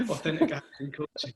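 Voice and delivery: says the name through a laugh. Real, not acted. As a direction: chuckle while saying the coaching business name. Teasing about the business name.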